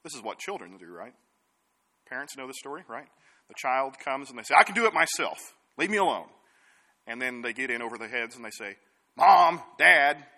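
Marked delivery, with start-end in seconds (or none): 4.6-6.3 lightly voiced stubborn child, louder; a hint of a kid pushing the parent away; defiant child. Child insists on independence. 9.2-10.2 loud whiny call to the parents; child calling out. Retold or imitated. Child in trouble calls parents.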